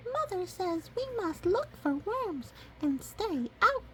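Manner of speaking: very high squeaky chick; tiny chick voice. Acted. Chicks answering the ducklings, very high and squeaky. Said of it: "they reply".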